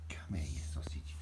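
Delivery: soft fond whisper; whisper to dog. Real, not acted. Owner whispers fondly to her dog, hushed and tender.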